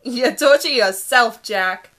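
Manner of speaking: teasing retort. A narrator's reading. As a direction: throw it back at him playfully. Brenna teasing back, drawn out.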